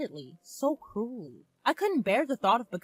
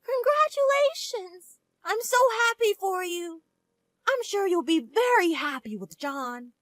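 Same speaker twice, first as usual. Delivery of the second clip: much higher, louder, joyful mother; overjoyed mother; 10 semitones higher, 6 dB louder than usual. Acted. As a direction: gush with tearful joy. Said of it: "blessed us from the bottom of her heart".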